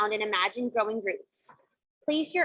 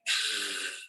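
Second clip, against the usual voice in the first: noisy lion's-breath exhale; big rushing breath, like a lion; 7 dB quieter than usual. Shown as a demonstration. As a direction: demonstrate a forceful voiceless breath out. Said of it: "So this is your lion's breath".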